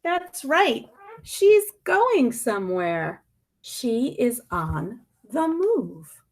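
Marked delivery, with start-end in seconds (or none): none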